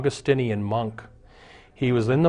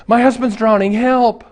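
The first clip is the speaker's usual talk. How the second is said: much higher and louder, frantic cry for help; panicked wife yelling; 9 semitones higher, 7 dB louder than usual. Acted. Wife screaming to the lifeguard to save her drowning husband, frantic.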